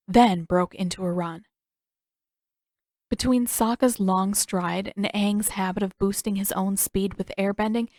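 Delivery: ordinary delivery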